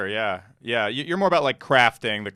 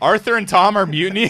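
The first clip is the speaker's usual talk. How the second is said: mock outrage; 7 semitones higher, 6 dB louder than usual. Mock outrage over mutiny.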